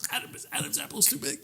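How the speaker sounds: strangled, high, faint croak; can't-talk choked voice. Pretend. Disguised man hampered by giant Adam's apple, mock choked. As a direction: squeeze words past a blocked throat. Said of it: "not be able to talk".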